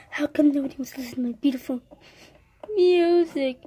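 fast whispered complaint. Acted. Sulking child whispering her complaint quickly.

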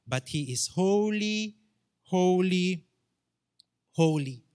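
preacher proclaiming holy holy holy; triple holy. Shown as a demonstration. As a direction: swell into the repeated holy. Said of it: "a word is repeated three times".

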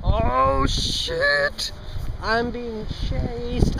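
high, drawn-out fake alarm; hammed-up cry of being chased; mock panic. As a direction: pretend to flee in terror. Pretends to be chased, just kidding.

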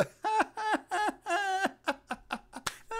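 long, very high cackle; mock Satan laugh. Imitating Satan laughing at a yawner, high cackling laugh. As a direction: cackle in exaggerated glee. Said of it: "Satan laugh at him".

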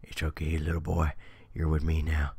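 low mock creep purring to a little boy; creepy ice cream man. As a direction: play the predatory ice cream man luring a kid. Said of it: "He's like".

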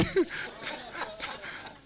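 high, soft chuckle; real laughter. Real, not acted. Preacher amused by his lone supporter, soft high laugh.

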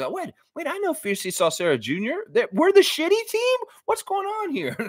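put-on high squeaky child's voice; hammed-up youngster's dismay; shocked kid. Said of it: "what if one of those kids".

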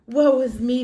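plain reading voice

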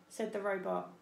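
ordinary delivery

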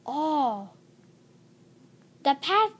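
ordinary delivery, nothing marked

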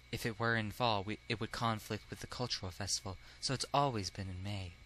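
put-on soft, low, timid boy character; hushed, low classmate voice; mild-mannered classmate. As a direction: explain it very quietly and gently.

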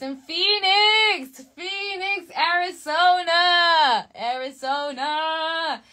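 high sing-song shout with stretched vowels; excited announcement. Real, not acted. Streamer revealing the final tour stop, sing-song excitement. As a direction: sing out the place name like an announcer, long and high.

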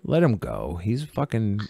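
low, soft, mildly dismissive quote; dismissive shrug. Acted. Viewer shrugging off Matt's mistakes, mildly dismissive. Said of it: "you're just like".

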